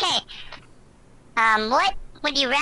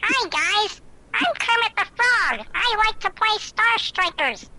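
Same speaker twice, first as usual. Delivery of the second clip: higher puppet frog voice; chirpy cartoon frog, pitched up; Kermit the Frog voice; 5 semitones higher than usual. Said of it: "the Kermit the Frog voice that I'm about to do".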